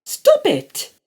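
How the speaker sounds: very high loud plea; frightened mother. A narrator's reading. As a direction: squeal it out in fear. Mother pleads.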